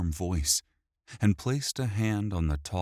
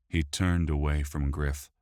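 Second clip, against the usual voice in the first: ordinary delivery, nothing marked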